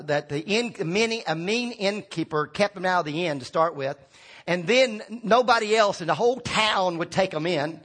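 ordinary talk, nothing marked

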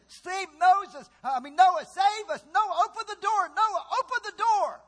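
loud, high begging for rescue; pounding on the ark, pleading loudly; desperate cries. Acted. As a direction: cry out to Noah to open up. Preacher voices drowning people begging.

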